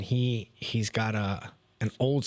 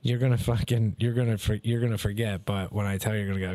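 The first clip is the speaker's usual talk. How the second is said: ordinary talk, nothing marked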